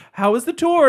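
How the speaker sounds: chirpy coworker. Retold or imitated. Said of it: "people were like".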